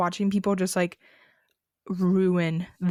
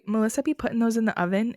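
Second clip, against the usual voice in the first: normal talking voice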